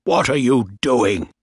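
mildly angry demand. A narrator's reading. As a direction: demand it with a touch of outrage. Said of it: "Bligh said angrily".

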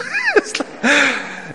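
light real chuckle; amused laugh. Real, not acted. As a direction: laugh at the story. Speaker laughs at scene.

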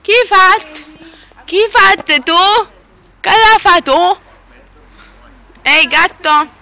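loud, slow call. Real, not acted. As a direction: call out to the cat. Calling to the cat.

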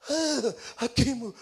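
slow, laboured patient with heavy sighs; mock aching groan. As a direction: act too sore to move. Mimics a hurting patient.

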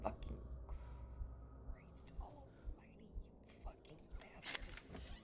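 furious whisper. Real, not acted. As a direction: whisper the curse through fear. Monster nearby.

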